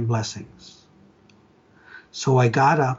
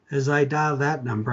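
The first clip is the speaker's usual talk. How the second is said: ordinary talk, nothing marked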